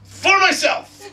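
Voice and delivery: slow, high, loud proclamation; drawn-out mock-heroic shout; selfish battle cry. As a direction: proclaim it with grand selfish pride. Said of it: "And Loki goes".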